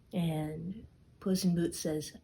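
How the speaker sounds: ordinary delivery, nothing marked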